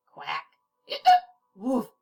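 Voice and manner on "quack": ordinary delivery, nothing marked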